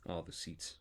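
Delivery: put-on soft, measured traditional accent; put-on non-American accent. Actor restarting out of America, careful put-on accent. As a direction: hold back, softly, in a non-American accent. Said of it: "just read it no accent".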